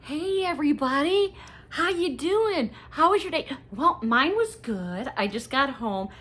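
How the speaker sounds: high, bubbly mock intro voice; Erin's intro. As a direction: greet the viewers like a cheerful YouTuber. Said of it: "we love Erin from Hey Everybody".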